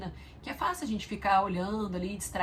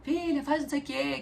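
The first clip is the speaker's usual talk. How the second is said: a playful imitation of yelling to a child from afar; mock calling out; 7 semitones higher than usual. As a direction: imitate her old habit of hollering at the daughter. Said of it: "tô gritando alguma coisa".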